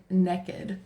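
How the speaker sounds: "naked" said as "nekkid"; short "eh" in the first syllable of "naked"; her own pronunciation. Shown as a demonstration. Mother showing how she says it, "naked" as "nekkid". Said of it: "making fun of me 'cause I say naked".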